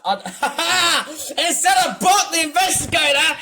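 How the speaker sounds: high, laughing outburst. Real, not acted. Mocking the game's title with a new name, laughing. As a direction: burst out laughing into the words. Amused by own rename joke.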